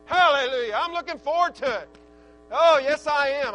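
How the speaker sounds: loud, high shouts of praise; exultant preaching. Real, not acted. Preacher praising ahead of the youth meeting, exultant preaching. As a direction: cry it out in praise, at full force.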